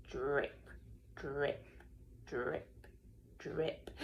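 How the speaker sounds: low, slow, deliberate drips; dripping sound. A narrator's reading. Narrator sounding out water dripping in the house. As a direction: drip it out one by one.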